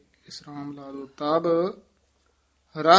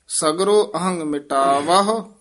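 slow and louder, then a clean stop; slow, with a pause after; 5 dB louder than usual. Shown as a demonstration. Teacher models the halt for the student. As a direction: recite slowly and halt after ਮਿਟਾਵਹੁ. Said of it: "ਇੱਥੇ ਠਮਕ".

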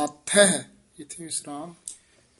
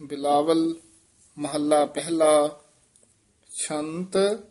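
ਬਿਲਾਵਲ, ਮਹੱਲਾ ਪਹਿਲਾ and ਛੰਤ set apart with clear pauses; each word separated. Shown as a demonstration. Teacher reading the heading word by word for the student. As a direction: read ਛੰਤ on its own, not run into ਦੱਖਣੀ. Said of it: "ਨਖੇਰ ਕੇ ਪੜ੍ਹਨਾ ਹੈ".

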